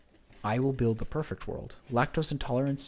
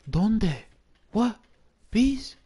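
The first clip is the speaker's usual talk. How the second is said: loud, high-pitched alarmed questions; startled; 10 semitones higher, 6 dB louder, much slower than usual. Real, not acted. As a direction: blurt it out high in disbelief. Startled by bees appearing in game.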